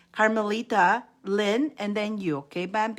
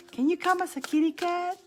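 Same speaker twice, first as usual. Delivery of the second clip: much higher quoted voice; imitated request; 10 semitones higher than usual. Host quoting Bambi's kitty cat request, high mimicry. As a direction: lift the pitch high to mimic a viewer asking. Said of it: "She asked one day".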